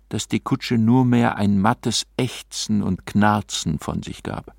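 ordinary delivery, nothing marked

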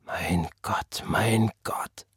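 slow, hushed whisper. A narrator's reading. Father whispers to God after the beating, hushed. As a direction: whisper it low, as if to yourself. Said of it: "flüsterte sein Vater".

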